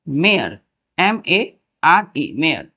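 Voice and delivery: normal talking voice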